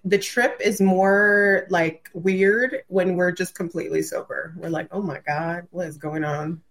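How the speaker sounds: ordinary talk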